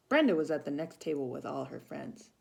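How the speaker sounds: ordinary delivery, nothing marked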